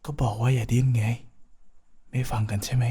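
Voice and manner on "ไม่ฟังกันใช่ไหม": hushed playful scolding; teasing whisper. Acted. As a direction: whisper it like a tease.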